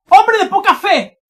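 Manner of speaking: high, very loud shouted rebuke; grito de exclamación. Shown as a demonstration. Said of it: "con grito". (exclamación a gritos)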